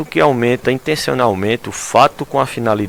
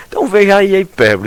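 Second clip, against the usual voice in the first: excited exclamation; 9 semitones higher, 5 dB louder, faster than usual. Real, not acted. Teacher's enthusiastic wrap-up.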